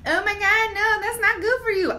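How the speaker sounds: high-pitched mock fuss about fatty food; mock health nag. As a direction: play a prissy dieter gasping. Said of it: "stuff that you're normally like".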